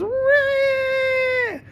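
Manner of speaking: shrill mock baby cry, very high; baby wail. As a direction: wail like a newborn. Baby crying in acted scene.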